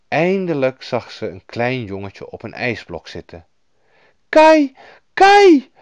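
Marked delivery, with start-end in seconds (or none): 4.3-5.7 put-on high, very loud girl's cry; piercingly high full call. A narrator's reading. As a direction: shout to a long-lost friend. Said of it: "riep Gerda".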